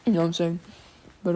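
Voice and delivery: ordinary delivery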